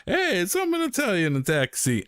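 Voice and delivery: high, hammy mock taxi voice; hammed-up Italian taxi. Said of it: "I was gonna say an Italian".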